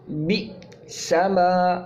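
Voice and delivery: بئس ما very slow, glottal catch on the hamza after بئ; Quranic pronunciation demo. Shown as a demonstration. Teacher showing students the hamza, very slow. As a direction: sound out بئس ما slowly with a clear hamza stop. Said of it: "جھٹکا دے کے پڑھا جاتا ہے".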